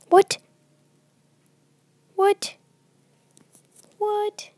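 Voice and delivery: high, loud startled cries; real jolting alarm; shocked yelps. Real, not acted. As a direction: react to a sudden scare. Jumpscare shock.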